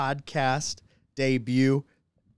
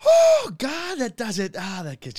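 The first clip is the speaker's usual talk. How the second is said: high, strained yelp that fades; loud outburst sinking back down; recoiling from a shot; 10 semitones higher, 7 dB louder than usual. Real, not acted. Host gasping after a tequila shot, recoiling.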